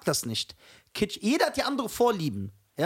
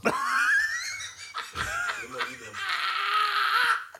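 long, high-pitched laughing squeal; real squealing laughing fit. Real, not acted. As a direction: burst into a drawn-out, very high laugh. Bursts out laughing at the pizza talk.

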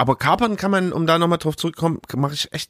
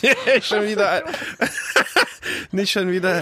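giggling through the words; laughing while talking; 5 semitones higher than usual. Real, not acted. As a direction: fight back laughter. Laughing at own joke.

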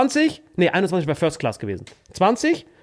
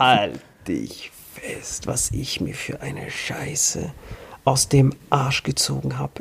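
whispered, slow, teasing; 3 semitones lower, 4 dB quieter, much slower than usual. Real, not acted. Comedian teasing co-host before the punchline, slow whisper. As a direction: draw it out in a hush. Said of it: "teasing build-up".

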